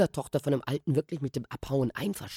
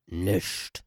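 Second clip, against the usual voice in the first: put-on gruff old man; 6 semitones lower, 3 dB quieter than usual. Old man's gruff one-word reply.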